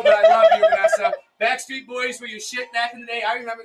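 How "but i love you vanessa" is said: high, loud shout of love across the room; loud call. Real, not acted. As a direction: belt out the affection. Calling out love to sister.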